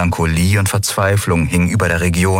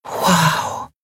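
breathed wow; 10 semitones higher than usual. A narrator's reading. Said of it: "hauchten Nelen und Miko unisono".

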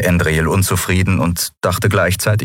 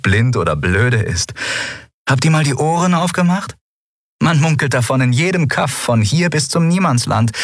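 lightly voiced young woman, higher and mocking; Lien, amused and scoffing; 5 semitones higher than usual. Lien mocks the crew's fear of the cult, amused and higher. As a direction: tease them for their fear, amused.

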